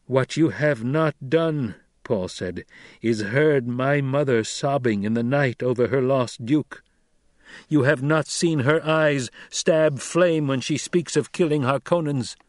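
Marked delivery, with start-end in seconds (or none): 0.1-1.8 slow, raised, deliberate accusation; grave reproach. Acted. Son reproaching his mother's captor, grave and deliberate. Narrator voices Paul reproaching. 3.0-12.4 slow, intense, deliberate delivery; grave reproach. Acted. Son describing his grieving mother to her captor, intense. Narrator voices Paul reproaching.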